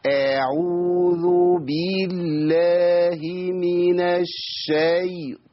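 every vowel in أعوذ بالله من الشيطان dragged out; invented long vowels. Shown as a demonstration. Teacher shows the error, all vowels of أعوذ بالله من الشيطان stretched. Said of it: "معتاد على توليد المدود".

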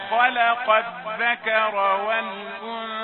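slow, drawn-out chanted recitation; Quran recitation. Shown as a demonstration. Teacher reciting verses to his students in slow tajweed chant. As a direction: intone the verse slowly and loudly.

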